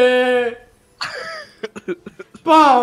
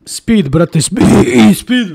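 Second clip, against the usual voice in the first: loud laughing insistence; loud insisting; 3 semitones lower, 10 dB louder, much slower than usual. Real, not acted. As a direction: push the word speed.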